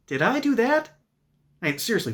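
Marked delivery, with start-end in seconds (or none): 0.1-0.9 high, nerdy sitcom whine; Steve Urkel impression. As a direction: squeak it out like a clumsy nerd. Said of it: "the dude looks like Steve Urkel".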